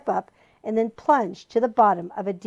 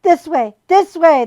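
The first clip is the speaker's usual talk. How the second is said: high loud call; calling out loudly; 7 semitones higher, 10 dB louder than usual. A narrator's reading. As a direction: beckon them from afar. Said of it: "the voice called again".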